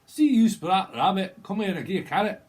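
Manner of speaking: put-on loud, high comic Scottish accent. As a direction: holler like a cartoon Scot. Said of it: "Someone from Scotland".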